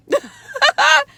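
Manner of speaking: high, loud burst of real laughter; real laugh after the rant. Real, not acted.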